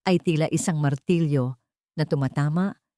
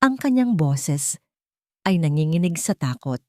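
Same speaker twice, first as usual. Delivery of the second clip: ordinary delivery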